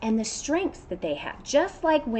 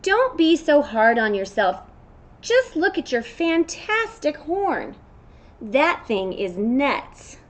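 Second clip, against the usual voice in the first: louder and slow, pumping up a friend; storybook goat cheering a friend; encouraging goat; 5 semitones higher, 7 dB louder, slower than usual. Acted. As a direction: hype up your buddy.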